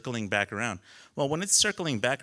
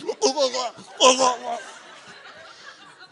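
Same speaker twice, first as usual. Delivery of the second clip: high, loud spluttering gurgles; mimed drowning gurgle; 12 semitones higher, 5 dB louder than usual. Retold or imitated. Parasailer dragged through waves, acting out gurgling. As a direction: gurgle as if waves cover your face.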